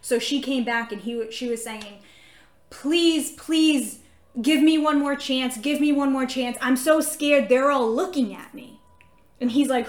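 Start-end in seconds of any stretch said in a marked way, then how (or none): 2.7-8.8 loud, raised, scared begging; pleading. Retold or imitated. Girl begs pastor for one more chance, scared pleading. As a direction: imitate a frightened girl begging for another chance.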